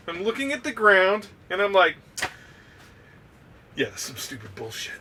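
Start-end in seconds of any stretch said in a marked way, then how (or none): 3.8-4.9 low, soft grumble under the breath; muttered thought. Retold or imitated. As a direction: mutter it to yourself, unimpressed. Muttering to himself during chaos.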